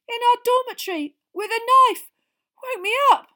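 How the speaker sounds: high, loud, alarmed outcry; panicked. Acted. Ron telling Percy of a knife in the dormitory, panicked. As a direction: blurt it out in high-pitched alarm.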